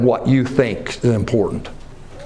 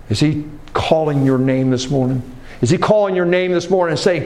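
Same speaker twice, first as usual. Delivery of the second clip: usual speaking voice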